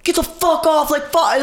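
high-pitched imitation of an irate yell; high mock scream; retold screaming. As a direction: mimic someone screaming out of a car window.

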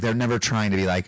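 usual speaking voice

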